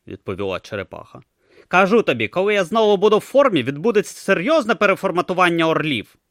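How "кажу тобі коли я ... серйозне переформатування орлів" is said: high, loud, cranky little god voice; grumpy tortoise god. Acted. Tortoise god to Brutha about eagles, high cranky bluster. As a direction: bluster like a small furious deity. Om voice held.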